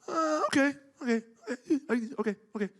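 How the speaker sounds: high, giggling repeated okay; mock Larry chuckling; laughing okays. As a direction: laugh through the words.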